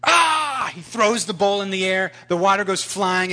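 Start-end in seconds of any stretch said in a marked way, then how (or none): 0.0-0.7 high loud yelp; 1 startled cry. Retold or imitated. Holy man flings the bowl, loud yelp. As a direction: cry out in alarm, sharp and loud.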